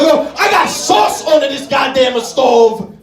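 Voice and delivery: loud mock Italian cook griping; over-Italian outburst. Comic as his own cook self, mock loud griping. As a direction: huff about the sauce. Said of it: "I'm like".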